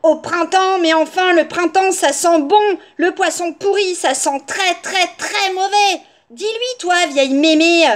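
put-on high, loud, insistent child; exasperated little girl. A narrator's reading. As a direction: argue indignantly, hammering each point.

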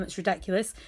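normal talking voice

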